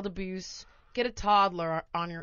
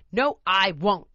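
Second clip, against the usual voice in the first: put-on stubborn toddler; 7 dB louder, slower than usual. Toddler refusing.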